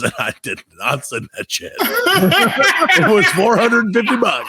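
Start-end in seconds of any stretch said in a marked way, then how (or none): 1.8-4.5 high laughing outburst over the price, slowed down; laughing disbelief. Real, not acted. Telling a friend the absurd shipping price, laughing high and slow. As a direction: laugh it out, stunned by the cost.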